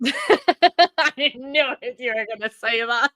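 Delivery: laughing it out, slowly. Real, not acted. To Paula after guessing her answer, laughing. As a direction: caught out mid-laugh, delighted.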